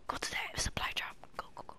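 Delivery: urgent whisper. Real, not acted. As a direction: hiss the orders quietly. Urging quietly while hiding.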